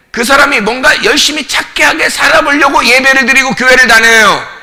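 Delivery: loud, insistent sermon voice; forceful pulpit explanation; impassioned preaching. Real, not acted. Preaches with raised voice.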